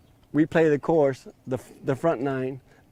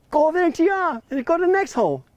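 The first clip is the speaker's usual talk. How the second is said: a much higher, louder shout; exasperated yell; 5 dB louder, faster than usual. Retold or imitated. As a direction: yell it for the third time. Owner repeating instructions, yelling.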